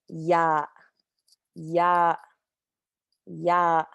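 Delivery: "ya" with a plain Y; demo ya. Shown as a demonstration. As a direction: say ya lightly for learners. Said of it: "just makes a Y sound".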